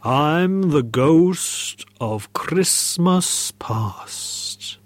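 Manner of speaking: very slow, stretched-out ghost; ghostly voice. Acted. Ghost reveals itself to the gaffer, slow haunting voice. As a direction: draw every word out like a haunting spirit. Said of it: "said a firm voice".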